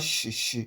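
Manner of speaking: hushing sh, no stop before it; soft continuous "sh" as the wrong sound. Shown as a demonstration. Teacher showing learners the sound to avoid, sh sound. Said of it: "does not give you the sh".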